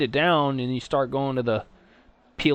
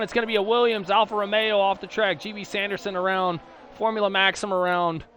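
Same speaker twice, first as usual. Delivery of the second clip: higher, brisk race commentary; live play-by-play; 6 semitones higher than usual. Real, not acted. Sim race commentator calls the opening laps to viewers, higher and lively. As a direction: keep the excitement up calling the action.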